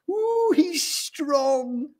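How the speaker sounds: very high, drawn-out coo; swooning admirer. A narrator's reading. Doris sees Gordon's display, swooning. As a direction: stretch out the admiration.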